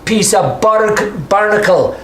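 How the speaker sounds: high, slow gruff order; grizzled dwarf barking orders; gruff NPC. Acted. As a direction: growl the order at the guards. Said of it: "He says".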